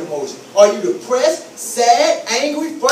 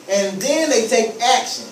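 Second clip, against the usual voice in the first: ordinary talk, nothing marked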